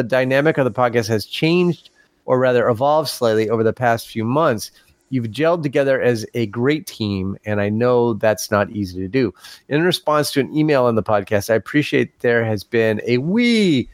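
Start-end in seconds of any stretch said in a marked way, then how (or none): none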